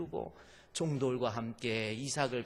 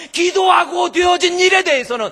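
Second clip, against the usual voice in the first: much louder and higher, every syllable hit; shouted; 14 semitones higher, 16 dB louder than usual. Acted. Preacher roaring the lesson at the congregation, shouting. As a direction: bellow it out with full force. Same line, now shouted.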